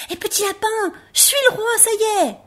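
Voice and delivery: very high, triumphant rabbit cry; calling out. Acted. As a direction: shout that you finally made it. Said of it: "il cria".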